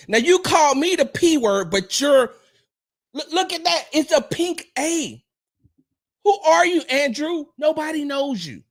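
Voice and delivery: high-pitched, worked-up telling-off; incredulous, high and emphatic scolding; indignant rant. Real, not acted. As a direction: go off on a troll, voice way up. Scolds a troll in comments.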